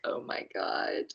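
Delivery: breathless whisper, slow; hushed embarrassed gasp of words; mortified whisper. Retold or imitated. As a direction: whisper it in horror. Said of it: "I was just like".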